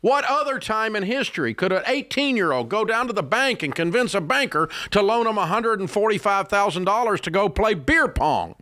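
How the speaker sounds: high, fast, disbelieving questions; incredulous rant. Real, not acted. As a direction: hammer the absurdity home.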